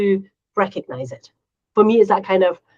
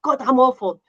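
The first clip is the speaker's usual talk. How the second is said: higher, louder mock-dramatic complaint; over-the-top kid's lament; imitating a dramatic kid; 7 semitones higher, 3 dB louder than usual. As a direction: ham up a child's overblown verdict. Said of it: "Whenever they say something".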